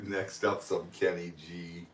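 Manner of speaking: low, soft imitation of a DJ; sleepy radio voice. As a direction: echo it as a drowsy radio host. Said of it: "The sleepy radio voice".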